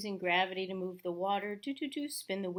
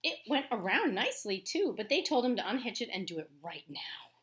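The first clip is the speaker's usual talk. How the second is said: louder, measured read-aloud voice; story narration; 2 semitones higher, 5 dB louder than usual. A narrator's reading. Teacher reading a picture book to her class, storytelling voice.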